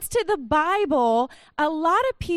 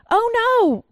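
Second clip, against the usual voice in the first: panicked cry; 8 semitones higher, 6 dB louder than usual. Acted. Servant sees surrounding army.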